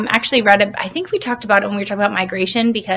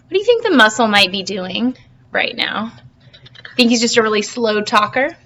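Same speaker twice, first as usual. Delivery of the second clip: ordinary delivery, nothing marked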